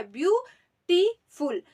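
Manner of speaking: slow three-part count-out of ब्यूटीफुल; syllable by syllable. Shown as a demonstration. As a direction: say ब्यूटीफुल as ब्यू, टी, फुल, pausing between each. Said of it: "तीन syllable हम use कर रहे हैं". (ब्यूटीफुल का हर syllable अलग-अलग, रुक-रुककर)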